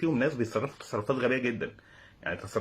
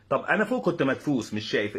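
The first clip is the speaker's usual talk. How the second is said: ordinary talk, nothing marked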